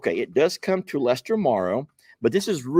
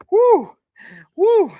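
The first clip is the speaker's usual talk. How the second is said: very high, loud whoops; two loud high whoops; 10 dB louder than usual. Real, not acted. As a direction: cheer the box open. Cheering after opening the box.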